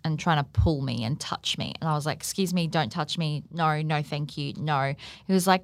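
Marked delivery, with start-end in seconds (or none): 2.2-4.9 soft, polite plea to be left alone; hushed, nervous refusal; quiet retold plea. Quietly telling the drunk man to stop touching her.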